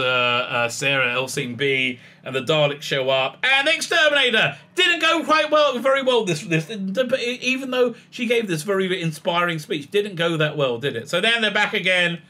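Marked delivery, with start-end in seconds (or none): none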